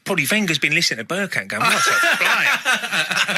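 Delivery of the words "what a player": drawn-out, high mock praise with a laugh. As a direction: stretch it out slowly like a hammed-up compliment. Bergkamp mocked, laughing.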